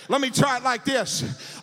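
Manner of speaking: ordinary talk, nothing marked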